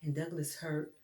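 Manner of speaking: ordinary delivery, nothing marked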